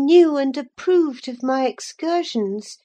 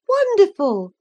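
high exclamation of mock wonder; exclaiming; 6 semitones higher, 4 dB louder than usual. A narrator's reading. Catherine responds to her cousin's boast of reading, exclaiming. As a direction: exclaim it, pitch jumping up. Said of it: "I exclaimed".

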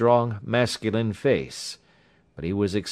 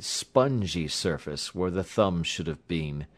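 ordinary delivery, nothing marked

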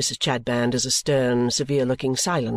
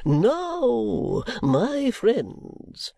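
high, slow, unctuous sermonising; oily preacher voice, drawn out; pompous preacher; 9 semitones higher, much slower than usual. Acted. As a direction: play the greasy self-satisfied preacher. Chadband resumes.

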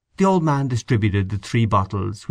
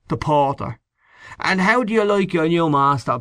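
put-on higher, gossiping old man; old caretaker voice; 6 semitones higher than usual. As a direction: lean in and share the scandal. Old man retells porter gossip.

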